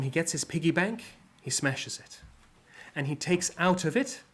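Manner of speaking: ordinary talk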